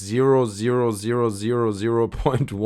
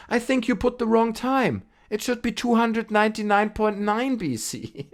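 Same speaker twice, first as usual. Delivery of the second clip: higher, know-it-all voice; smug commenter; 10 semitones higher than usual. Retold or imitated. Vlogger reads a smug corrector's comment in a high voice. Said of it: "Marcus Elliot comments".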